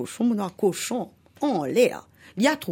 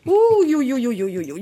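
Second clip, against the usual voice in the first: «ouh yo yo» aigu et fort, puis qui retombe; cri de douleur; 5 semitones higher, 4 dB louder than usual. Acted. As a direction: hurle comme un cochon piqué. Cochon piqué par la guêpe. (le cochon piqué crie de douleur, aigu et fort)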